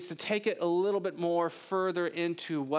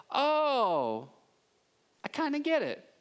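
dawning realization; 8 semitones higher, slower than usual. Retold or imitated. Gradual dawning of understanding.

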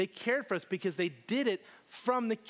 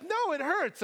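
put-on high-pitched child's complaint; imitated little daughter; 12 semitones higher, slower than usual. Daughter answers dad's question, imitated high child voice. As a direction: complain in a small girl's high voice. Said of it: "And she's like".

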